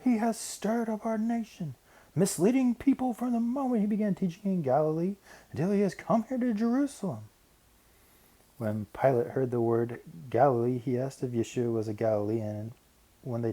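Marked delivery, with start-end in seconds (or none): 0.0-7.3 high, strained mob shout; crowd yelling. A narrator's reading. Angry crowd demands Pilate act, high yelling. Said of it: "they yelled and demanded".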